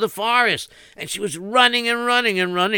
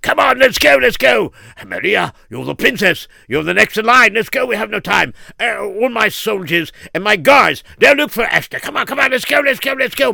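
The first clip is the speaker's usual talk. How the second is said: louder, higher hurried urging; urgent calling; 4 semitones higher, 5 dB louder than usual. Acted. King urges Maria to flee the fire, loud and urgent. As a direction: push her out the door, fast.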